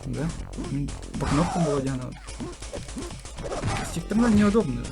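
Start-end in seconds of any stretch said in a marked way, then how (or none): none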